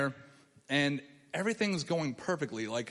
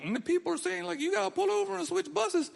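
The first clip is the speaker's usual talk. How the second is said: much higher, put-on telling voice; autopilot storytelling; 13 semitones higher than usual. Telling the bus story from muscle memory, in a much higher put-on voice. My mouth's going.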